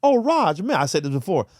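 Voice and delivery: a hint of a startled acquaintance; imitating friends. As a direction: play the shocked friend who never guessed. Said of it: "that people always say to me".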